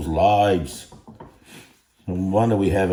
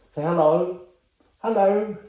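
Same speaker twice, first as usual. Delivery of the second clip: much higher sing-song voice for the dog; 10 semitones higher than usual. Real, not acted. Owner asking his dog to greet the viewers, high-pitched. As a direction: coo it like talking to a puppy.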